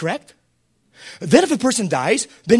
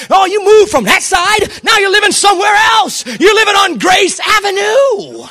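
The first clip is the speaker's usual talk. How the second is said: preaching at full force; 10 semitones higher, 6 dB louder than usual. Real, not acted. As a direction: lift the congregation with a shout. Preaching climax.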